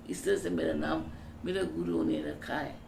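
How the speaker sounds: ordinary talk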